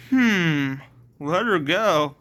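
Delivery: musing, toying repeat; mocking echo. Alice considers Dylan's demand, mockingly. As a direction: mull over the demand mockingly.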